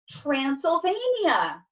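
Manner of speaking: high, drawn-out exclamation; triumphant cry. A narrator's reading. Cat revealing the answer, triumphant. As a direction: proclaim it slowly in triumph. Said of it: "he cried triumphantly".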